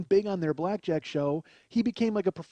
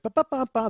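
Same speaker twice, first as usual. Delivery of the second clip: high "ba ba" fanfare; imitated show music; 8 semitones higher, 6 dB louder than usual. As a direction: mimic the outro theme with your mouth. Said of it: "They're playing the music".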